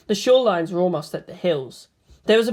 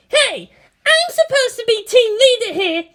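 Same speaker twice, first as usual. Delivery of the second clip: much higher and louder, indignant; piping up; 6 dB louder than usual. Acted. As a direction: insist you're the leader. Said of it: "Asuka piped up".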